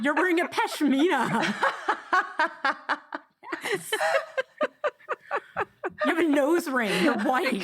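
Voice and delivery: giggling through the words; teasing while laughing. Real, not acted.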